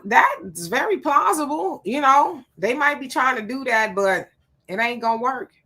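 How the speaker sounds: normal talking voice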